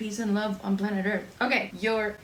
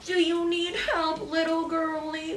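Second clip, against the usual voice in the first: put-on high, patronizing sing-song to a girl; mocking a patronizing mechanic dude; condescending mechanic; 7 semitones higher than usual. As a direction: talk down to her like a smug mechanic. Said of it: "like, super condescending".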